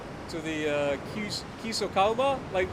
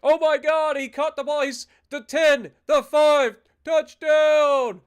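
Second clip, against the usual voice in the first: yelling play-by-play, high and loud; excited touchdown call; 7 semitones higher, 11 dB louder, slower than usual. Retold or imitated. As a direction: shout the touchdown like a thrilled fan. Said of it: "all of a sudden you hear".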